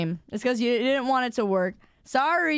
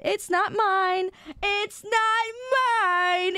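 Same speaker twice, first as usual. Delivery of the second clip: stretched-out, higher-pitched denial; mock indignant; 7 semitones higher, slower than usual. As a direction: protest it slowly with playful indignation.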